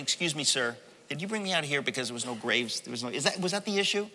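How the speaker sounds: soft, mock-meek sarcastic question; understated cheeky fake politeness; being fresh. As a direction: ask quietly with feigned innocence, hurrying it. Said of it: "That's called being very fresh".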